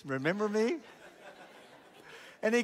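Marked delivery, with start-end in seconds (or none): 0.0-0.8 playful, laughing jab at the heckler; a sly, amused gotcha; teasing. Retold or imitated. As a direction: tease him with a grin. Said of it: "I just did one of these".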